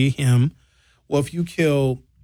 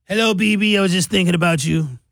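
mock Trump; 4 semitones higher than usual. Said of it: "And Trump answered the phone".

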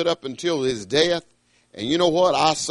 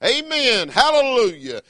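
church hallelujah; 8 semitones higher than usual. Shown as a demonstration. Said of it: "you know what I say every once in a while".

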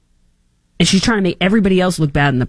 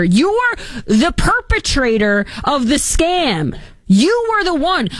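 indignant accusation; 10 semitones higher, slower than usual. Real, not acted.

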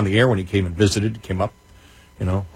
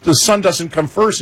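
whiny complaint; 10 semitones higher than usual. Retold or imitated. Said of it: "I'm like".

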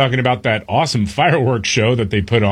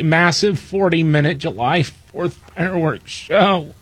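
higher mock whine; whiny liberal voice; 4 semitones higher than usual. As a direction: whine out the headline. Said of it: "in my whiny liberal voice".

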